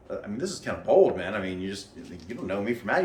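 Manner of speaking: ordinary talk, nothing marked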